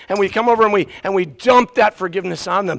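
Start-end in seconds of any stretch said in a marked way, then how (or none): none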